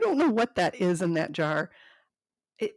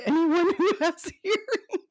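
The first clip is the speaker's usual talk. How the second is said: higher, louder, slow, laughing; 8 semitones higher, 5 dB louder, slower than usual. Real, not acted. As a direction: deliver the punchline cracking up. Reading joke note's punchline.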